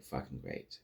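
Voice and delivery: low, soft, breathy sarcastic mutter. Retold or imitated. Cecil reacting to Nolan's two weeks, sarcastic mutter. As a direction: grumble it under your breath.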